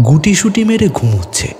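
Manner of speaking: normal talking voice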